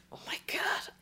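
breathless unvoiced whisper. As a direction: hush it in dismay. Realizing own mistake.

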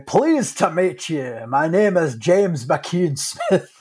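slow, put-on Scottish accent. Co-host playing a doctor introducing himself, Scottish accent. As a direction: imitate a gentleman with a Scottish accent. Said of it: "expecting that accent to come out of".

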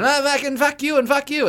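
high, hammed-up sweary outburst; mock foul-mouthed rant. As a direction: play a comic cursing at the crowd. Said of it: "us who are up there going".

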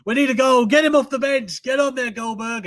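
high, loud football-crowd plea; urging fans' shout. Acted. Fans calling for a substitute striker, urgent shouting. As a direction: yell it like a desperate fan from the stands.

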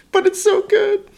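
squeaky high swoon; way up high, savoring it; high falsetto. Pretend.

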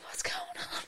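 hushed whisper in class. Retold or imitated. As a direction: whisper it like you're stuck mid-exam. Confused pupil during exam.

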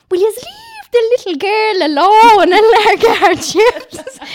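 put-on loud, high, laughing Tipperary accent; big Tipperary accent. Friend Joanne scolding the queue in a thick Tipperary accent. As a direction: bellow it like a fierce Tipperary woman, laughing. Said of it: "In a big Tiff accent".

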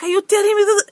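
high-pitched indignant retort, imitating the bride; retold arguing. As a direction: throw it back in mock disbelief. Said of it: "they were arguing".

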